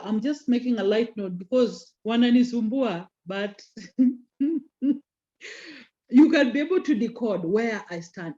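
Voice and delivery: ordinary talk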